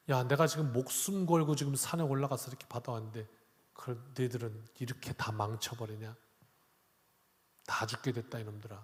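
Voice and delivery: hushed mock rebuke. Moses rebukes people, voiced softly.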